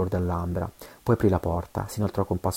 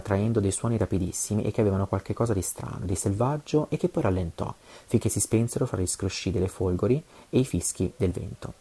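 ordinary delivery

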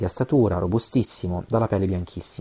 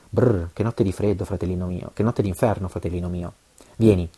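ordinary delivery, nothing marked

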